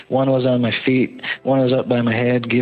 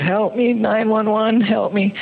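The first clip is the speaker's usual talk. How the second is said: high desperate cry, much higher; screaming for help; 10 semitones higher than usual. Retold or imitated. Runner screaming to a hiker for help. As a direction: call out for help in panic. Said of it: "again, I screamed".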